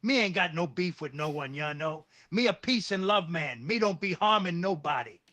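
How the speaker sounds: put-on Jamaican Rasta accent; Rastafari patois. Refusing Tony's job, mock Rasta patois. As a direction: turn it down as a peaceable Rasta in patois. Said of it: "reply to him with a Rastafari patois".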